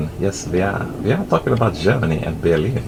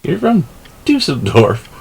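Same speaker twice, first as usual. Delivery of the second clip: amused disbelief; 7 semitones higher, 3 dB louder than usual. Retold or imitated. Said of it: "cracking up because they said Dusseldorf".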